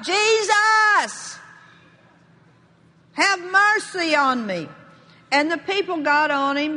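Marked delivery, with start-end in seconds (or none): 0.0-4.7 high, drawn-out shouted plea; crying out. Acted. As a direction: call out to Jesus over the crowd. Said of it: "He began to cry out".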